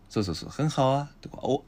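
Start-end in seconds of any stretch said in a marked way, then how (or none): 0.5-1.1 很好啊 rising, with a tiny 啊 at the end; barely audible final 'a'. Shown as a demonstration. Teacher confirms learner's try, 很好啊 with faint final 'a'. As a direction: lift 很好 and barely add 啊. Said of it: "聞こえるか聞こえないかわからないぐらい".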